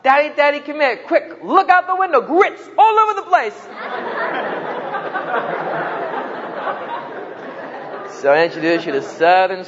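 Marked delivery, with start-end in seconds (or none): 0.0-3.5 put-on high, excited child's shout; little boy yelling to his daddy about the snow, high and excited. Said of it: "he started to shout". 3.8-8.2 laughing. Real, not acted. Laughing at own punchline.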